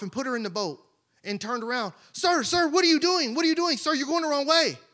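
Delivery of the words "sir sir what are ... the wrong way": higher, urgent calls of sir; alarmed calling out. Acted. Bystander calling the man back from the boat, alarmed. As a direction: call after him as he walks away.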